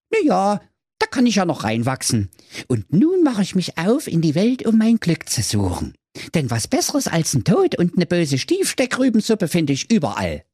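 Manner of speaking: high cheeky little knight; high squeaky Manni voice. Acted. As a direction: squeak it out like a cocky boy. Manni character voice.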